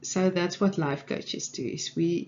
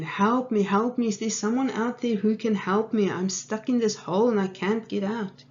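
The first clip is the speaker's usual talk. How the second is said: higher mock cry for help; retold scream; 5 semitones higher, 4 dB louder than usual. Man still stuck in the hole calls again, mock screaming. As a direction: act out a pleading call, half screamed. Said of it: "he screamed again".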